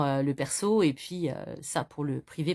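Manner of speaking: ordinary talk, nothing marked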